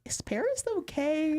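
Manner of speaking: worried mom voice. Retold or imitated. Voicing worried people.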